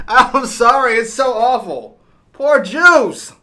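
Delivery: high, loud wail of sympathy; loud, high-pitched lament; loud dismayed outcry. Real, not acted. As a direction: cry out in dismay for someone on screen. Viewer reacts in distress to scene.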